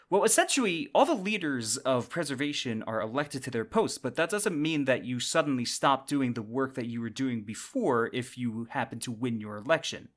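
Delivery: ordinary talk, nothing marked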